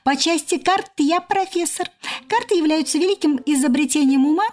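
squeaky cockroach voice. A narrator's reading. Cockroach lecturing as professor.

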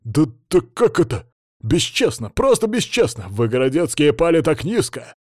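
indignant sputtering protest, pitched high; возмущённое возражение. A narrator's reading. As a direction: protest in rising outrage, cut off mid-phrase. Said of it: "попытался что-то возразить". (возмущённо, захлёбываясь, всё выше и громче)

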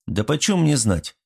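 mildly angry. A narrator's reading. Artyom answers Svat's pressing question, mildly angry. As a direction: answer back, slightly cross. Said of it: "рассердился Артём".

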